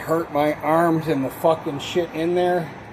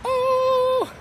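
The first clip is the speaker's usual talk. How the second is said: surprised oh. Retold or imitated. Reacting to cat sign.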